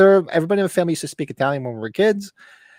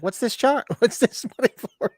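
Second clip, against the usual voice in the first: high mock scolding wife; 9 semitones higher than usual. Husband mimics his wife asking about the money. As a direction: pitch up as the annoyed wife. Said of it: "She's like".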